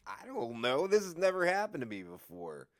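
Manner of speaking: mild panic, pitch climbing high; retold panic. Storyteller panicking at her first arrest, rising mock panic. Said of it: "like, panicking".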